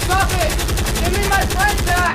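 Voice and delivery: loud desperate shout; yelling. Acted. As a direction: yell it at the monster. Fighting monsters, yelling.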